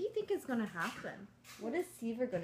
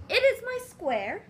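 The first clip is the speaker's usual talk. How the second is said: high, proud little voice; small spider voice; 10 semitones higher, 10 dB louder, slower than usual. Acted. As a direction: squeak it out proudly. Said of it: "Seaver said".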